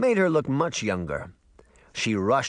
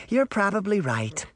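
higher, lightly sighed agreement; mild sigh; 9 semitones higher than usual. A narrator's reading. Teacher agreeing with Sabrina, mildly sighed. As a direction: concede with a touch of a sigh. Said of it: "the woman sighed".

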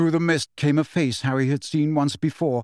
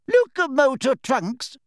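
high, slow squeaky spell; 11 semitones higher, 3 dB louder, slower than usual. A narrator's reading. Flitwick casting on the luggage, squeaky. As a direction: squeak the spell like a tiny wizard. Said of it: "He squeaked".

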